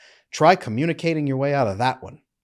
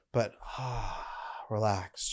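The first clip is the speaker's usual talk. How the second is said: relaxed sigh; 3 semitones lower, 10 dB quieter, slower than usual. Shown as a demonstration. Demonstrating relaxed posture, sighing.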